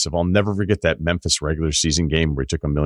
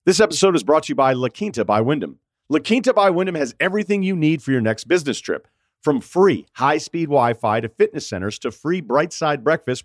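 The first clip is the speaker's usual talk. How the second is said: upbeat commercial read; 7 semitones higher than usual. Acted. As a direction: sell it like a radio spot. Sponsor ad read.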